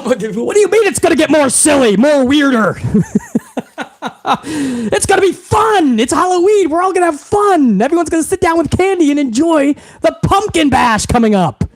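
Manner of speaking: high, laughing mock cheer; giggling parody of forced fun. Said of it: "mocks the announcer, laughing".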